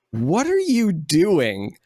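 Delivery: stretched-out, baffled mock question; acted bewilderment. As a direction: imitate a wife's stunned disbelief. Wife's baffled reaction, acted out.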